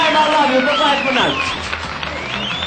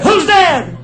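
a loud, higher call into the dark; 7 semitones higher, 5 dB louder than usual. Acted. Farmer hears a creak upstairs, loud higher call. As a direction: shout it up the stairs, suspicious.

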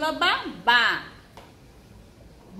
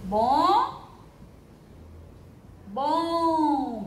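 "Bom, bom" stretched with a long pause between; drawn-out syllables; 3 semitones higher than usual. Shown as a demonstration. Teacher dictating for two boxes, syllables drawn out. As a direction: hold each "bom" as its own syllable. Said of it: "Só tem dois quadrinhos".

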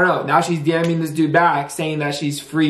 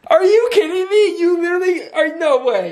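caught-out outrage, voice way up; shocked yelling; 13 semitones higher than usual. Real, not acted. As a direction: yell it out in shock, laughing through it. Catches girlfriend texting prank account.

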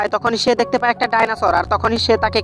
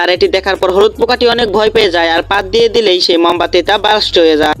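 louder, lower storyteller voice narrating the contest; lively cartoon narration; 4 semitones lower, 5 dB louder than usual. A narrator's reading. (জোরালো cartoon বর্ণনা)

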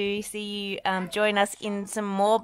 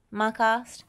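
ordinary delivery, nothing marked

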